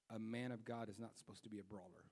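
low, soft solemn recital; scripture coming to mind. Retold or imitated. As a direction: quote it quietly, as a rebuke. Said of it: "That scripture came to my mind".

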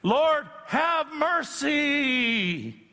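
high, slow, drawn-out plea; crying out for mercy. Acted. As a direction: cry out like the blind beggar. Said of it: "when we're like Bartimaeus".

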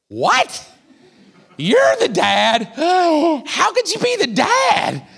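high, slow shocked cry; shocked disbelief. Retold or imitated. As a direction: gasp then disbelieving shout. Joseph voiced in retold story.

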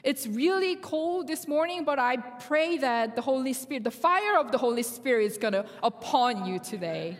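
ordinary delivery

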